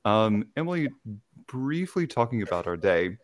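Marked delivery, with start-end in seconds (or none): none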